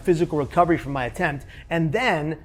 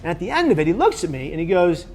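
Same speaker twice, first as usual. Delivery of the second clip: ordinary talk, nothing marked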